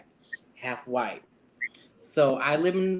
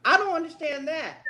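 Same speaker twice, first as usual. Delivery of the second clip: much higher, stunned disbelief; baffled, can't believe what she heard; shocked disbelief; 10 semitones higher than usual. Retold or imitated. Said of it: "I'm like".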